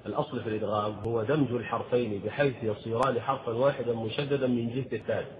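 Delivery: ordinary talk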